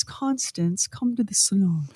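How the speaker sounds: put-on old-movie Mid-Atlantic accent, softly. Shown as a demonstration. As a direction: say it like a 1940s film star in Mid-Atlantic accent. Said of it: "they talk like this".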